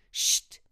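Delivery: loud hissed shh; sharp hush. Acted. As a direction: hush her with a sharp hiss. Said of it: "zischte sie".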